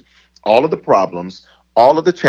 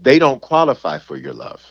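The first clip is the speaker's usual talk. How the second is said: ordinary talk, nothing marked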